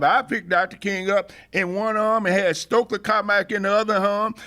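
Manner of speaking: ordinary delivery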